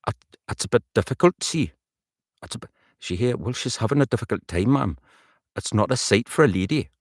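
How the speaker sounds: lilting Geordie character line; Newcastle accent. Shown as a demonstration. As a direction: rehearse the Newcastle woman with rising tune. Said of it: "see then if that is gonna get me".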